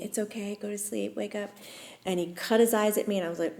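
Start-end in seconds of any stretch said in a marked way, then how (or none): 0.0-1.5 put-on soft, gentle soothing to a child. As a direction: hush him back to sleep. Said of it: "And so I gently".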